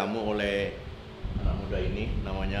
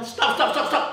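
shouting stop; 12 semitones higher, 5 dB louder than usual. Acted. Cuts off the take.

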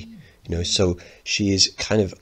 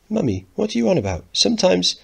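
put-on high impatient boy; 12 semitones higher, 3 dB louder than usual.

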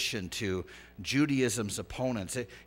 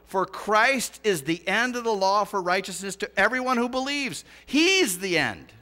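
loud, raised-pitch sermon delivery; urgent preacher driving the point home; impassioned preaching; 6 semitones higher, 7 dB louder than usual. Real, not acted. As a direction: proclaim scripture with rising force.

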